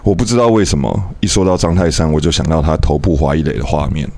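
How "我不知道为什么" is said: normal talking voice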